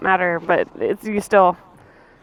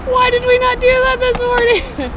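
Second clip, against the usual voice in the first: excited; 6 dB louder than usual. Real, not acted. Excited reply.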